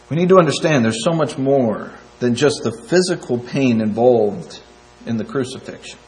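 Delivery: ordinary talk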